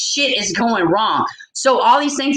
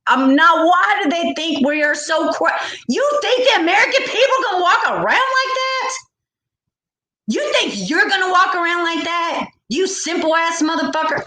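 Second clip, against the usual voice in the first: angry rant; 7 semitones higher than usual. Real, not acted. Vlogger ranting at officials, outraged and high. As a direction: pitch up high, voice cracking with outrage and a laugh.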